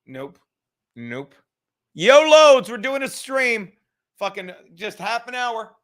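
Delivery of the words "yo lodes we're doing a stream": loud call across the room. Real, not acted. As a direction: call his name out loud. Calling out to Lodes.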